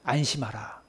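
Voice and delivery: soft, low reassurance; tender. Acted. Jesus reassuring blind Bartimaeus, soft and low. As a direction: gently comfort a frightened man. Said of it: "이렇게 말씀하십니다".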